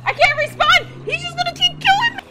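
real despair, screaming; sobbing shriek of panic; desperate wailing scream. Real, not acted. Trapped in a respawn kill loop.

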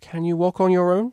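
high-pitched, hopeful question. A narrator's reading. Cellist asking her unsteady friend to walk, hopeful. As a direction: ask with a lifted, hopeful voice. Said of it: "she asked hopefully".

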